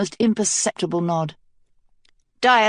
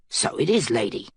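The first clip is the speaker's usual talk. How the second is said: muttered; 7 semitones lower, 3 dB quieter than usual. A narrator's reading. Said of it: "Mace muttered".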